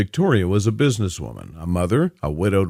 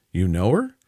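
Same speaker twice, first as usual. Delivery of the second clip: ordinary delivery, nothing marked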